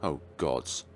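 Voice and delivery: plain reading voice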